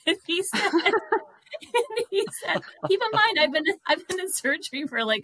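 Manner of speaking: cracking up mid-story; real laughter breaking into the telling; laughing. Real, not acted.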